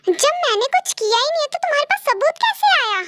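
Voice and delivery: high, outraged denial; indignant protest. Acted. V protests to Nancy that no proof can exist, indignant and high. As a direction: push back hard, stung by the lie.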